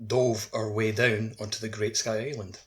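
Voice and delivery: normal talking voice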